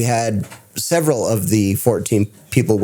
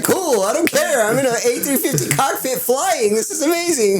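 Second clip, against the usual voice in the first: much higher, breathless giddy voice; giddy dismissal. Retold or imitated. Av geek to the captain in the cockpit, giddy and high. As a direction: brush it off, too thrilled to care. Too excited to listen to captain.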